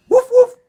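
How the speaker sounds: high, loud yappy little dog woofs; dog bark. Acted. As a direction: bark like a tiny excited dog. Dog barks in story.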